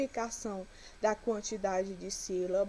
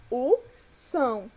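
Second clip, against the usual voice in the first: "são" pronounced with more force, slow and loud; drawn-out, emphatic "são"; stressed-syllable demo; 2 semitones higher, 6 dB louder, slower than usual. Shown as a demonstration. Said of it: "pronunciamos com mais força".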